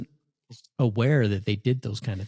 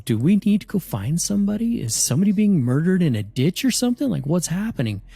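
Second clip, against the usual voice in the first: higher, anxious rush of worried questions; mock alarm; 7 semitones higher than usual. Family at the fire pit asking each other, mock worried. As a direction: play it as half-joking panic. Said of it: "everybody's kinda looking at each other going".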